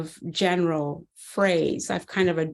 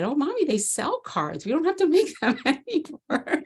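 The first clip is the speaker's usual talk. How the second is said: put-on little boy voice; 8 semitones higher than usual. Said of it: "he said".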